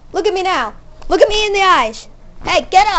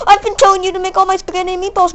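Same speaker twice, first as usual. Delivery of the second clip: fast; 3 semitones higher, faster than usual. Real, not acted. Ranting at the cat.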